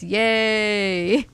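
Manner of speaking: flat, bored monotone yay; deadpan monotone cheer. Shown as a demonstration. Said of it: "in my monotone voice".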